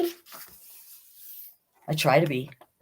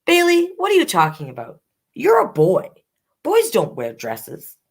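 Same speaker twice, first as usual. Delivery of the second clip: much higher, louder exasperated mother; scolding mother; 8 semitones higher, 3 dB louder than usual. Acted. Mother refusing Bailey's dress, louder exasperated scold. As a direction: snap it like a fed-up mom.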